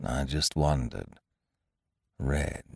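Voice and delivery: soft, slow, low donkey murmur; murmured to himself. A narrator's reading. Eeyore musing on the red balloon, murmured to himself. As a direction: say it softly inward, downcast. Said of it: "he murmured to himself".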